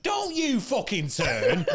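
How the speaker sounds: high, slow, drawn-out warning; indignant comic retort; mock angry warning. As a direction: act out scolding a crowd that turns on you. Acted retort to a hostile crowd.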